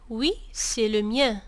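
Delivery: slow, careful Oui, c'est le mien; slow answer demo. Shown as a demonstration. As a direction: model the answer slowly. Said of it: "أنت تجيب". (إجابة نموذجية بطيئة)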